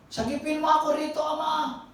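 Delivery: high, fast mock plea for rescue; pleading child. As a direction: cry out to the father, half in jest. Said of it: "Nasermonan pa tuloy".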